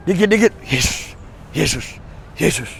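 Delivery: imitating a careless believer; muttered Yesus. Mocking casual name-dropping of Jesus.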